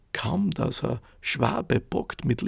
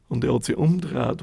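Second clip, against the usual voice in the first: ordinary delivery, nothing marked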